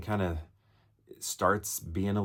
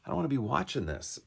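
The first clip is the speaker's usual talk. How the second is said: higher, faster whiny I don't wanna be watching this; whiny plea; 7 semitones higher, faster than usual. Retold or imitated. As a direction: plead it up high, quickly. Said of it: "where I was just like".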